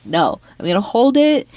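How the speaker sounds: ordinary delivery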